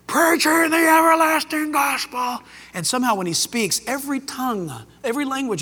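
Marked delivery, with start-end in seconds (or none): 0.1-2.4 loud angel voice. Acted. Preacher voices the angel flying through heaven, loud proclamation. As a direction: call it out like an angel across the sky. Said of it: "with a loud voice".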